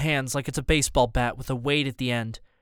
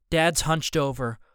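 ordinary delivery, nothing marked

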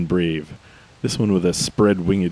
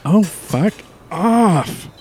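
angry outburst; 12 semitones higher, 5 dB louder, much slower than usual. Acted. Angry at low fuel warning.

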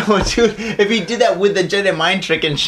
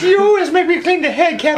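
whiny sailor; 10 semitones higher, 3 dB louder, faster than usual. Retold or imitated. Mock sailor complaining, high.

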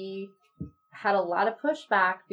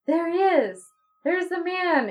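high, loud burst of recognition; sudden animated cry; 10 semitones higher, 5 dB louder, slower than usual. Retold or imitated. Wife in a trance suddenly spots the man, excited cry. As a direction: blurt it out as if spotting him. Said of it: "she becomes animated".

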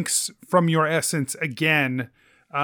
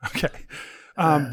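ordinary talk

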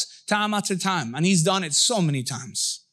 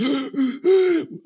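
mock exhausted gasp; 6 semitones higher than usual. Runners out of breath.

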